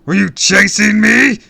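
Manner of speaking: very loud, high villain roar; bellowed. A narrator's reading. Villain demanding answers from the kid, bellowing. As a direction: bellow the question like an accusation. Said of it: "he bellowed".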